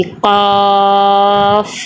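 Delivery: क़ाफ़ with the long aa held very long; elongated madd. Shown as a demonstration. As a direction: stretch the vowel of क़ाफ़ on one steady note, then close on f. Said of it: "तो इसको हम ज़्यादा लंबा करेंगे".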